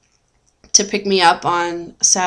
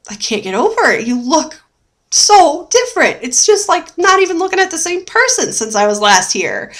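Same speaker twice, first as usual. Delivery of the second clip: higher, stunned disbelief; amazed in-law; 8 semitones higher than usual. Retold or imitated. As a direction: imitate the mother-in-law's astonishment. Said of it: "almost shocking to them".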